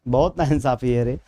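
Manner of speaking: low-pitched mock complaint; mock grievance. Teacher to live students over missing likes, mock grievance. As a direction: lament the injustice in a low, playful voice.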